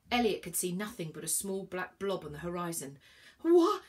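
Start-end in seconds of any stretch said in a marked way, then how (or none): 3.4-3.8 loud, high, rising question; high, loud startled question; startled cry. A narrator's reading. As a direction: cry out in alarm, pitch rising. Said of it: "he started again".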